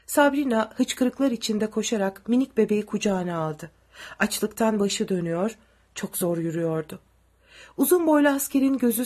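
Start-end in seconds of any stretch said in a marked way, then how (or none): none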